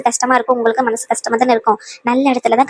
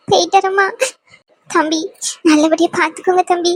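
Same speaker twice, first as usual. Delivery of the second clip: tearful farewell; 4 semitones higher than usual. Acted.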